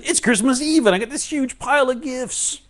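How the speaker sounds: high, loud Christmas Eve excitement; giddy glee. Acted. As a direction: squeal it like a kid facing presents. Acting Christmas Eve thrill.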